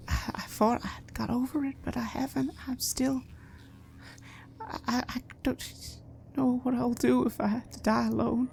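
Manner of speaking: high, soft, breathless confession; hushed, high, shaky admission; frightened whisper. Acted. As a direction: whisper the fear as if afraid to say it. Nigel calmer, frightened.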